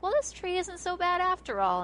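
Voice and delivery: high-pitched mock character quote; quoted cartoon characters. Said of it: "They're like".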